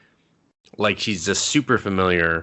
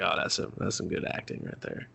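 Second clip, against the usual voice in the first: hushed, impressed whisper; soft under-the-breath admiration; whispered aside; 3 semitones lower, 11 dB quieter than usual. Retold or imitated. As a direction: murmur it like a private compliment. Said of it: "And I was just like".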